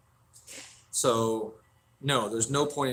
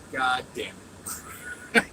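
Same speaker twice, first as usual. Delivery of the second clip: near-whispered retold curse; muttered exasperation; 3 dB quieter than usual. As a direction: curse under your breath, as in a retold story. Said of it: "I was like".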